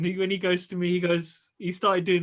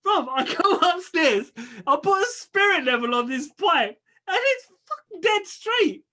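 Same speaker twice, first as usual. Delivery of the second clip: high, laughing incredulous telling; real laughter building to the punchline; laughing disbelief; 10 semitones higher than usual. Real, not acted.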